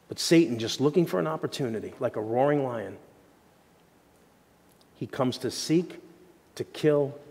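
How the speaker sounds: normal talking voice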